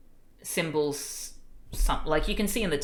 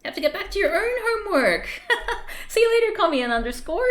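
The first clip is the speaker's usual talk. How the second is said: playful teasing; 9 dB louder, much faster than usual. Real, not acted. Teasing someone to go do homework.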